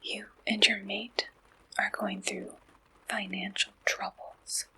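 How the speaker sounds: ordinary talk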